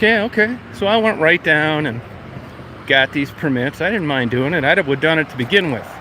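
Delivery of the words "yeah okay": sarcastic okay. Retold or imitated. Sarcastic reply to the inspector.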